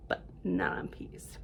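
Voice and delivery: whispered aside. Pretend. Reader whispers an aside to the children. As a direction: breathe it like a secret.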